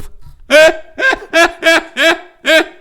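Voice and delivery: long, high, loud laughing fit; helpless laughter. Real, not acted. Streamer picturing grandpa as a pop-up book, helpless laughter. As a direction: lose it completely at your own joke.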